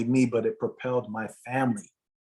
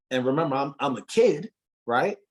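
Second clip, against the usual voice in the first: ordinary talk, nothing marked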